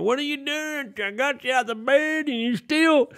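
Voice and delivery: high, exasperated parent scolding; mock parent freakout, pitched high; freaked-out parent. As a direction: play the parent losing it over the missed bus. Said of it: "They're gonna".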